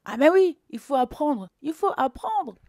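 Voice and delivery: mock them with exaggerated yes; sarcastic. Real, not acted. Mocking copying politicians, loud ironic agreement.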